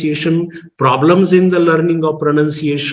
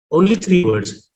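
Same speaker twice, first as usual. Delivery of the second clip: usual speaking voice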